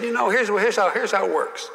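lightly drawled Southern senator; imitating Russell Long. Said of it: "he said".

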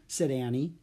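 ordinary delivery

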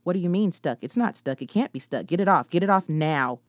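loud, rising frantic panic; panicked demands to get it off. Acted. Narrator panicking, stuck dress.

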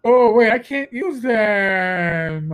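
very slow, stretched-out reaction; drawn-out mock dismay. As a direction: drag the last word right out. Mock reaction in Irish pub.

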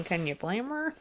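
ordinary delivery, nothing marked

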